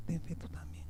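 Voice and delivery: hushed, urging him to keep faith; soft whisper of comfort; whispered reassurance. Acted. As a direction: breathe it out softly like a secret encouragement. Jesus gently urging Jairo's faith.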